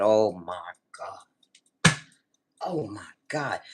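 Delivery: low, slow, hushed groaned words; stunned dismay. Retold or imitated. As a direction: sink into retold disbelief, nearly whispering. Retold dismay at price.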